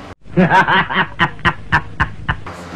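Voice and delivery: long staccato laugh trailing off. Real, not acted. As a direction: laugh in a string of separate ha's. Jokes about his empty wallet.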